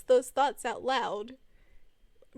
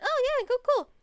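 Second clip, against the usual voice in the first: a much higher, louder breezy brush-off; feigned casual; 13 semitones higher, 7 dB louder than usual. Retold or imitated. Answering Ray Ray's gossip, breezy and high-pitched. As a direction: act like it barely matters. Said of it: "I was like".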